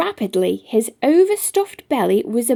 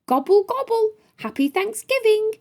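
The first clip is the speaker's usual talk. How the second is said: high, beaming turkey voice; grinning turkey; 10 semitones higher, faster than usual. A narrator's reading. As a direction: gobble it out with a big grin. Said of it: "replied Toby with webbed feet".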